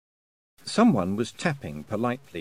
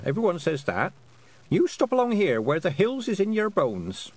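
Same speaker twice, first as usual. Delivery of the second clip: put-on higher-pitched old country granny; Granny Aking's storytelling; 9 semitones higher than usual. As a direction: tell it as a rustic grandmother, pitched up.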